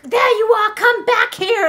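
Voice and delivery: loud, high acted chase yell; pursuers shouting. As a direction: shout after someone running away. Pursuers yelling after the runaway.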